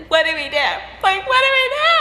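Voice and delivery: mock wail climbing high and loud; hammed-up tearful panic, building; imitating bawling. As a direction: imitate a sister bawling in despair. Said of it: "she starts bawling even harder".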